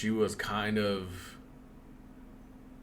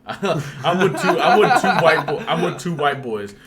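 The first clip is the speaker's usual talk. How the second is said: laughing; 8 semitones higher, 13 dB louder than usual. Real, not acted. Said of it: "laughing while retelling".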